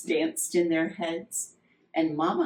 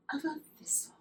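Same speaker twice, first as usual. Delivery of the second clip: whispered; 8 semitones higher, 10 dB quieter, much faster than usual. A narrator's reading. Soft ending of the line.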